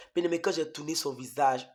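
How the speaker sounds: usual speaking voice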